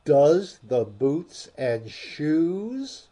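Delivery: slow, wondering question; puzzled, drawn-out asking. A narrator's reading. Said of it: "she repeated in a wondering tone".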